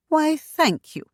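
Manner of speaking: high, lightly amused thanks; laughing thanks. A narrator's reading. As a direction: thank her with a touch of laughter. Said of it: "Shanna laughed".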